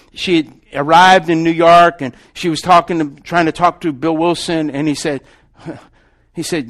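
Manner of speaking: usual speaking voice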